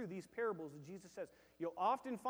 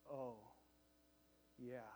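mock deflated; 9 semitones lower, 14 dB quieter than usual. Deflated reaction to heavy verse.

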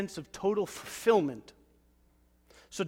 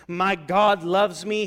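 louder and slow; bold declaration; 10 dB louder, much slower than usual. Real, not acted. Believer declaring faith, loud and slow. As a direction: declare it with conviction.

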